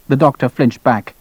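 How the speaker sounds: ordinary delivery, nothing marked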